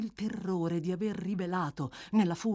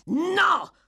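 loud, much higher furious shout; enraged one-word retort; 13 semitones higher, 8 dB louder than usual. A narrator's reading. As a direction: bark it back in a rage. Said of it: "ribatté l'ebreo infuriato".

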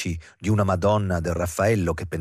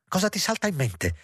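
trembling, hushed protest; 10 semitones higher than usual. A narrator's reading. Hendrik recoils from Juliette's demand, agitated protest. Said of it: "sussurrò, mentre le labbra gli tremavano".

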